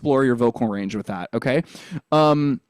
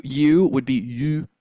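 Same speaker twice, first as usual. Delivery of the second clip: French accent. Shown as a demonstration. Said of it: "or you".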